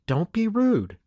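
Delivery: high-pitched motherly scolding; mother correcting her child's manners; admonishing. A narrator's reading. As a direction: reprove the boy firmly. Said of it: "Missus McNeil admonished".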